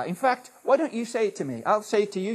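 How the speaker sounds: ordinary talk, nothing marked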